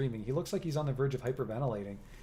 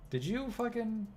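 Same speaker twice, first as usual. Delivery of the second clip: pitched much higher, a rough angry cut-in; raucous outburst; 9 semitones higher than usual. Acted. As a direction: cut him off, voice going raucous. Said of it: "her normally small voice growing raucous".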